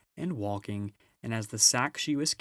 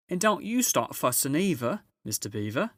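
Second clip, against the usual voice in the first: higher, bustling motherly beaver voice; a brisk, busy housewife giving orders; Mrs Beaver; 6 semitones higher, 5 dB louder than usual. Acted. Mrs Beaver ordering her husband to fetch supplies, bustling and higher.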